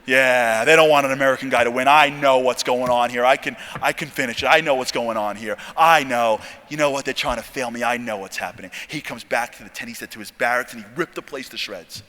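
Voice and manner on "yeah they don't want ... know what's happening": low, loud, insistent certainty; angry suspicious rant. Retold or imitated. Trainee blaming the Israelis for failing him, low angry rant. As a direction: hammer it out low, convinced of a plot. Mad trainee rants about conspiracy.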